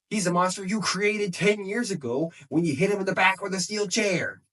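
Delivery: acted accusation. Sami answering the monster insult, imitated indignant accusation. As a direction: throw the blame back, imitating the wrestler. Acted quarrel, throwing blame back.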